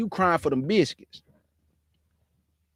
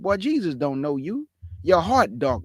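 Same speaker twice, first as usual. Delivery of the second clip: usual speaking voice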